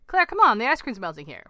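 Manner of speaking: slightly serious. A narrator's reading. Husband urging his silent wife to join in, slightly serious. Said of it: "his voice ever so slightly more serious".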